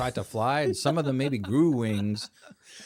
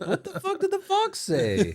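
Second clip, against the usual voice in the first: high mock outrage. Joking outburst, much higher.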